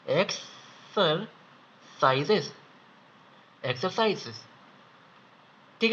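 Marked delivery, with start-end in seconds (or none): 0.1-2.5 slow repeat. Shown as a demonstration. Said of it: "एक और बार बता देता हूँ".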